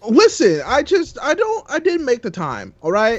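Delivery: high-pitched, hammed-up excuse; playfully flustered self-defense; mock defensive pleading. Playful defense after mock scolding.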